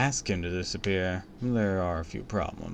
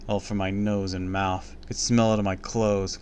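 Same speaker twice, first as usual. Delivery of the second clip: ordinary delivery, nothing marked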